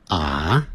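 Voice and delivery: "are" as an open "ah", no R; British silent R. Shown as a demonstration. Teacher shows British English, "are" without the R. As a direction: say "are" British style, tongue uncurled. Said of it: "在英式英语".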